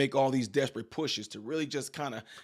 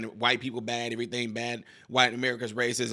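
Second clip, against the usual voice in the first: mock whining; 6 semitones lower, 5 dB quieter than usual. Vlogger imitating critics whining, lower and softer. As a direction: lower and softer, a mild mocking whine. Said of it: "now crying".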